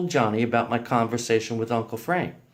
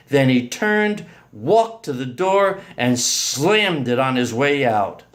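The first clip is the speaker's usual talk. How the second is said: ordinary delivery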